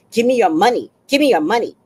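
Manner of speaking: loud demanding bark, imitating a greedy woman; mock demanding mother. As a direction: shout it like a grabby mother. Mother voiced demanding money.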